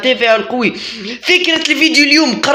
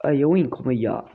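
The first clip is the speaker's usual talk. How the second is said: normal talking voice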